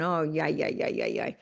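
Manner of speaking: mock brush-off, trailing low and flat; dismissive yeah-yeah. Coach mocking a client's excuses, dismissive yeah-yeahs. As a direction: wave it away with a lazy mocking yeah. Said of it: "I'm, I'm going".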